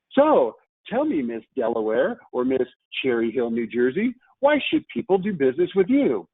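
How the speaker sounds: high, sing-song pageant contestant answer; perky contestant selling the company to the judges; beauty pageant contestant. Acted. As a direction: gush it like a beauty queen on stage. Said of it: "imagine her standing up there and saying".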